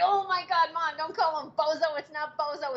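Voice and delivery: half-laughing mortified protest; retold scolding of mom over a nickname; exasperated correction. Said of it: "I was like".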